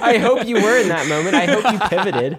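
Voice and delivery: laughing, high and loud. Real, not acted. Cohost reacts to the mom story, laughing high and loud. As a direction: crack up as you say it.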